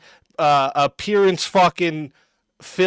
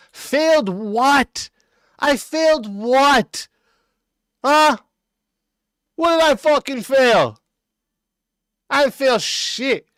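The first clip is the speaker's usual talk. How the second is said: higher-pitched, incredulous challenge; indignant; 7 semitones higher than usual. Real, not acted. Answering an accusation of failing miserably, indignant and high-pitched. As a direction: throw the accusation back, drawing out the questions.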